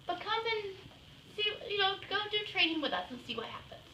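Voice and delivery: lightly voiced staffer talking someone round; coaxing service person. Service person urging the client to come train, coaxing. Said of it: "She said".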